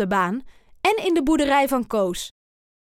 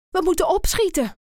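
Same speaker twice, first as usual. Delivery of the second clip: fast, higher urging; hurried; 7 semitones higher, faster than usual. A narrator's reading. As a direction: rush the words out. Aap urges hurry.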